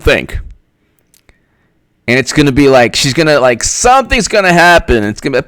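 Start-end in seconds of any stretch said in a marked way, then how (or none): none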